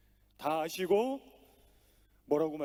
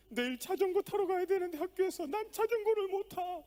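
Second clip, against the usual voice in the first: put-on crying little girl; 12 semitones higher, 4 dB louder than usual. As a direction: whine it like a sobbing daughter. Crying daughter speaks to father.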